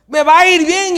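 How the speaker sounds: high, full-voiced preacher's shout; shouted confession of faith; bold declaration. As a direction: declare it at the top of your voice. Models bold positive confession, shouted.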